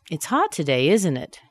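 ISN'T loud, then the tune drops; falling tag question. Shown as a demonstration. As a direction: expect a yes, falling on ISN'T IT. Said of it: "The falling intonation shows".

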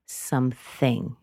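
slow "Something", dropping down; final, drawn-out downturn on "Something"; falling intonation. Shown as a demonstration. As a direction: sink on "Something" to close the thought. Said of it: "It really drops down there".

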